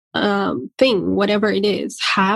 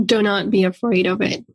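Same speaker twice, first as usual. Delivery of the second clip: normal talking voice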